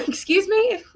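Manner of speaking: high incredulous excuse me. Retold or imitated. Reacting to the friend hiding the sighting, incredulous. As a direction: rise high in disbelief.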